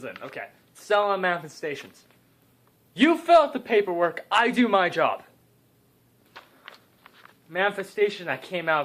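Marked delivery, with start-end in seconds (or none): 2.9-5.2 mock bossy retort.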